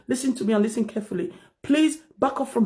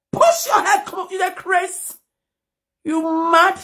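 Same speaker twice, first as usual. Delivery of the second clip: retold angry rebuke; 7 semitones higher, slower than usual. Telling off the man who pushed her, angry and high. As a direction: fire it back at him as an insult. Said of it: "I said".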